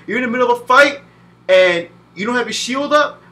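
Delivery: ordinary talk, nothing marked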